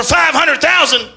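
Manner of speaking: five hundred "thousand" shouted high and loud, falling; Alex Jones rant. Retold or imitated. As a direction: bark "thousand" like a frantic host. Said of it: "Alex Jones is a conspiracy theorist".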